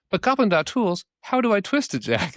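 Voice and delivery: high-pitched mock helpless questioning; pestering user. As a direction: play a clueless user pleading for help.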